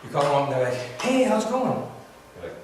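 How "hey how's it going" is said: high, loud, chirpy greeting; sudden energy. Acted. Suddenly happier friend answering the phone, energetic. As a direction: greet with a burst of cheer. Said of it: "their energy".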